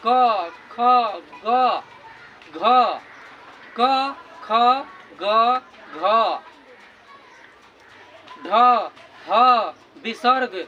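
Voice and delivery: loud separate क ख ग घ, each pushed from the throat; throat letter demo. Shown as a demonstration. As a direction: sound out each letter loudly with a pause. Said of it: "कंठ से होकर के आ रहा है". (कंठ से ज़ोर देकर वर्ण बोलना)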